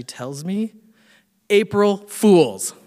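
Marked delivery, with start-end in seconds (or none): 1.5-2.7 slow, loud, higher mock-triumphant announcement; triumphant prank reveal. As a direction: imitate a gloating friend, drawing out each word loudly. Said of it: "getting ready to raise it and pump it".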